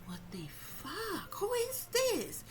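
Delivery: slow, breathy then shooting way up high; shocked disbelief. Retold or imitated. Reacting to the dream killer, slow stunned disbelief. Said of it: "I'm like".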